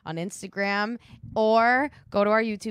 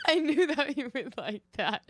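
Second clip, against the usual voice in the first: laughing; 8 semitones higher than usual. Real, not acted. Laughing while talking.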